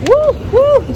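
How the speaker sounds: high, loud yelps; startled whoops. Real, not acted. Vlogger nearly tumbling on the escalator, loud high yelps. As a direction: yelp as you lose balance.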